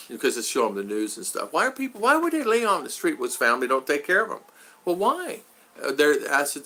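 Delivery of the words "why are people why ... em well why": lightly voiced puzzled kids, pitch leaping up on the whys; retold puzzled children. Daughters asking dad about people on the street, lightly imitated. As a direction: ask like a child wanting an explanation. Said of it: "the girls will ask me".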